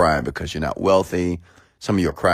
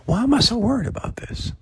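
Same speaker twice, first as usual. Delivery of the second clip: much higher, whiny mock worrier. Speaker imitating someone fretting over worries, high and whiny. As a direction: parody an anxious voice in your head. Mocking a worried person's self-talk.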